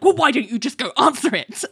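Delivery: retold impatient snap; snappish. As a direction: imitate snapping at a coworker. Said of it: "Ali gets snappish".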